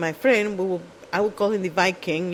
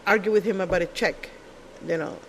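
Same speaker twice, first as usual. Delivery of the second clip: ordinary talk, nothing marked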